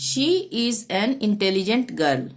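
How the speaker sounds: very slow, each word spaced out; slow dictation. Shown as a demonstration. Teacher reading an example sentence to pupils, slow and clear. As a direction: read it out slowly for the class.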